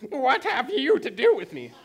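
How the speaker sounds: high, loud possessed-man voice; demoniac crying out. Acted. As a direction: cry out wildly as a tormented man possessed by demons. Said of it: "crying out with a loud voice".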